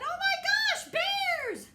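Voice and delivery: very high, loud mock scream; a frightened girl shrieking at bears; Goldilocks screams. Goldilocks freaks out.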